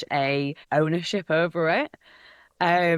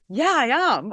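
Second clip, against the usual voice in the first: put-on Hull accent; 8 semitones higher, 5 dB louder than usual. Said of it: "such a distinct accent".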